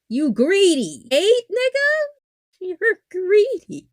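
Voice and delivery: loud, high, drawn-out disbelief; incredulous outburst. Real, not acted. Host still stuck on eight wives, incredulous outburst. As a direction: cry it out slowly, pitch shooting up.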